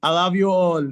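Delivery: normal talking voice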